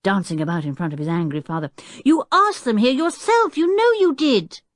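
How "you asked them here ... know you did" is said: high, loud accusing protest; excited boy arguing back; indignant child. A narrator's reading. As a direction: insist hotly to a forgetful father. Child argues with angry father.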